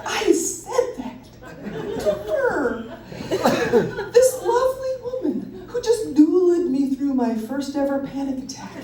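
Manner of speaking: high, hammed-up Southern drawl. Storyteller mortified before the lovely woman, played-up Southern drawl. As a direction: keep the mock Southern accent going. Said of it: "speaking in a Southern drawl".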